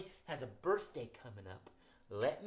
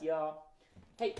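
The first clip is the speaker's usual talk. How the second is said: ordinary talk, nothing marked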